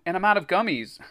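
high, mildly distressed whine; moaning. A narrator's reading. Greg out of candy for the blob, moaning. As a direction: whine it in dismay. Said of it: "Greg moaned".

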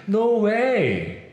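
normal talking voice

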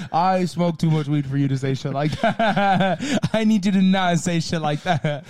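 real laughter breaking through the words; slow words squeezed out between laughs; laughing. Real, not acted. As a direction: laugh helplessly while talking. Laughing at cohost's remark.

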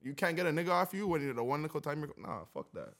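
usual speaking voice

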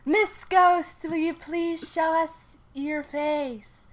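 high, slow, loud medium call; high, slow medium voice calling the ghost; summoning the ghost. Acted. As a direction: call into the dark like a seance medium.

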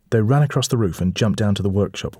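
usual speaking voice

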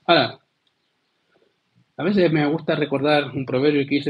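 ordinary talk, nothing marked

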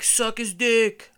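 imitating a yell from far across a playground; retold heckling yell. Guy in a slide jeering at strangers, yelling. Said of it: "is yelling from inside of a slide".

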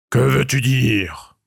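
slow, wary troll voice, lightly voiced; suspicious troll. A narrator's reading. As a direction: ask it slow and distrustful. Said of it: "demanda le troll méfiant". (troll méfiant, voix lente)

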